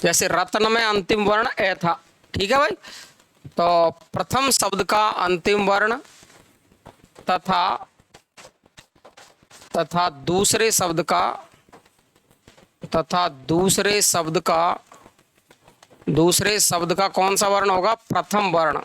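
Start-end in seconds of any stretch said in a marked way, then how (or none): 3.5-14.8 measured teacher dictation with long pauses; slow dictation. Real, not acted. Teacher giving students the definition of sandhi, slow dictation. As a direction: dictate phrase by phrase, leaving time to write.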